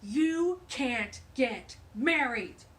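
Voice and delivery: loud, very slow menacing voice; scary voice. Acted. Stink bug forbidding the bunnies' wedding, slow and menacing. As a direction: loom over them, dragging each word. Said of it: "said a scary voice".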